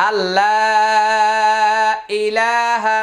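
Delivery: আল্লাহ ইলাহা chanted very slowly with long vowels; slow recitation. Shown as a demonstration. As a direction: recite it in a drawn-out Quran melody. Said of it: "এখানে লা তিন আলিফ টানতে হবে". (খুব ধীরে তিলাওয়াতের সুরে)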